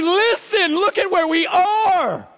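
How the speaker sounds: high, loud, slow angry rebuke; exasperated prophet yelling at everyone; furious scolding. Pretend. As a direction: scold the crowd at full pitch.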